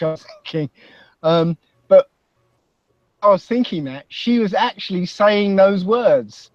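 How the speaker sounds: ordinary talk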